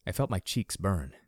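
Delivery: usual speaking voice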